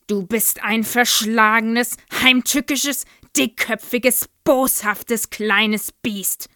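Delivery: loud, slow thundering insults. A narrator's reading. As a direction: pound out every insult. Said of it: "donnerte die Knüppelkuh".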